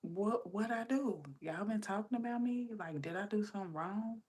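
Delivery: high-pitched acted-out worry; self-conscious questioning. Wondering if pantry workers talked about her, self-conscious. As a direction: plead to know, mock-worried and high. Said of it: "self-conscious".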